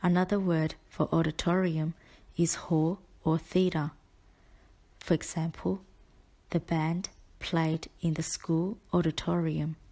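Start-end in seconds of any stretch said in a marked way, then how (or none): none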